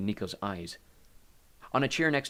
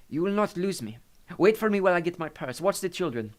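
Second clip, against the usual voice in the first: put-on high, reassuring Italian-accented voice; 8 semitones higher, 6 dB louder than usual. A narrator's reading. Maria reassures Hades before leaving, Italian accent.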